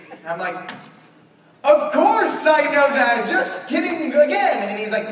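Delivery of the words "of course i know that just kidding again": playful. Retold or imitated. Covering blunder to host.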